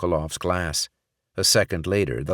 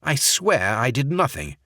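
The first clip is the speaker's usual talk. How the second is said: higher fake English accent, pleading innocence; 6 semitones higher than usual. Barrett pleading to Sokolov in fake English accent. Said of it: "in his fake English accent".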